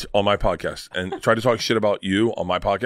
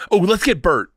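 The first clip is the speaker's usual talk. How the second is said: mock eager suggestion; 8 semitones higher than usual. Imagined casting people hit on Bert.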